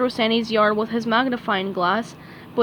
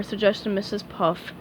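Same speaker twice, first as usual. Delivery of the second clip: ordinary delivery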